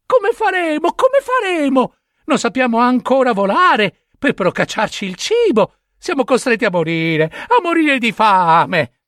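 put-on young ravens pleading in high frightened voices; crying baby ravens. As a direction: wail helplessly like abandoned chicks.

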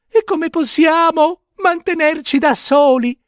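put-on young ravens pleading in high frightened voices; crying baby ravens. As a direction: wail helplessly like abandoned chicks.

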